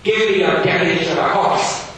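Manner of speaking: loud, high, stern schoolmaster. Acted. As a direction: bark it like a strict Victorian teacher. Gradgrind demands loudly.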